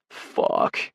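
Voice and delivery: strained curse through gritted teeth. A narrator's reading. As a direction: grit the curse out, all breath. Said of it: "he grits out".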